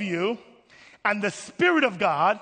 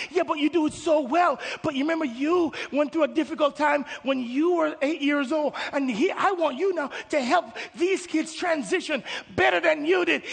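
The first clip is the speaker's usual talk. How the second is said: earnest pleading; 6 semitones higher than usual. Acted. God pleads back with the believer.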